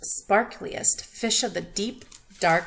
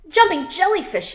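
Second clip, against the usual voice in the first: high, loud squeal; 9 semitones higher, 10 dB louder than usual. A narrator's reading. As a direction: squeal with delight. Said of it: "he squealed".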